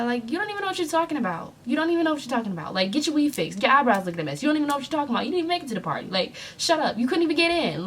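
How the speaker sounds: high, fast clapback; heated comeback. Retold or imitated. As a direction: tear into them without a pause. Snapping back at the girls.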